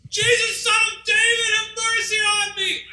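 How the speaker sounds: high, strained shout from far off; desperate cry for mercy. Acted. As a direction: cry out to Jesus as if across a crowd. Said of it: "he begins to yell".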